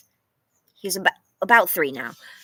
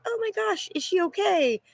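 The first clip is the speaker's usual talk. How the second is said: much higher, mock-panicked fretting; anxious worry; 10 semitones higher than usual. Dog owner acting out her anxiety about the dog, mock-panicked. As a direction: voice the worry that jumps up at once. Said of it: "cannot leave home without such anxiety".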